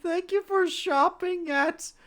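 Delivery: high, stammering nervous gnome; a jittery little gnome squeaking. Acted. Gnome thanks them.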